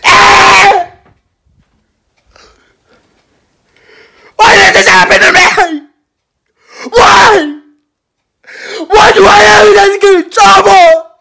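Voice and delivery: high, loud wailing sobs; crying. Acted. Boy sobbing loudly after being kicked out. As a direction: wail and sob at the top of the voice. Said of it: "Yeah, I was crying".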